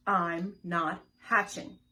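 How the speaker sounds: loud stubborn egg; loud stubborn refusal. Acted. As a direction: plant your feet and refuse. Egg's refrain, repeated louder.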